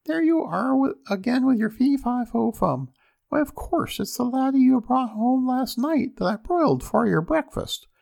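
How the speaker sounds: high-pitched scolding wife; ogre's wife. A narrator's reading. Ogre's wife scolding him about fee-fi-fo-fum, high voice. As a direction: chide in a piping high voice. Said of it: "the ogre's wife said".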